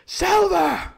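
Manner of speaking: very high, loud cheer; crew crying out. A narrator's reading. Pirates acclaim Silver as leader, crying out. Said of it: "they cried".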